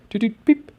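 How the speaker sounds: high-pitched little beeps; imitated bot blips. Trader showing the bot at work, high imitated beeps. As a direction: chirp the blips like a tiny machine.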